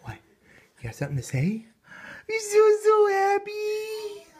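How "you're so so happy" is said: high, slow sing-song cooing. Real, not acted. Cooing to a baby.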